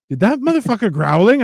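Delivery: high-pitched, startled question; incredulous bystander. Retold or imitated. Bystander asking about a growling man, incredulous. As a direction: ask it in mock disbelief.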